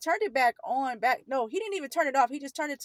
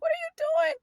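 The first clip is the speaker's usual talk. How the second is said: very high, loud panicked protest; scared, crying plea; 9 dB louder, faster than usual. Retold or imitated. Scrawny teen being dragged to back room, scared high-pitched cries. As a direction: re-enact a frightened child's cries, building louder. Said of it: "I was scared and crying".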